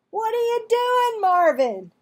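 high, loud puzzled call. A narrator's reading. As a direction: shout up at the boy on the web. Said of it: "Stuart called up to him".

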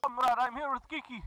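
hammed-up Russian accent, broken English; mock Russian accent, gamer joking; fake Russian accent. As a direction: play a comic Russian comrade in a Russian accent. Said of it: "tired of doing the voice".

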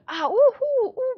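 very high, loud ooh of surprise; startled squeal. Real, not acted. Streamer surprised by a new audition screen, high excited squeal. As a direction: squeal with sudden delight.

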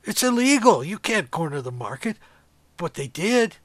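ordinary delivery

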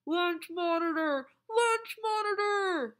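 put-on boy yelling a false alarm to the lunchroom; calling out. A narrator's reading. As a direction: shout it through cupped hands. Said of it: "cupped his hands ... and cried".

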